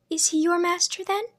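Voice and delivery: put-on high, innocent little girl; young girl voice. A narrator's reading. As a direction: ask it like a naive young girl. Girl's question.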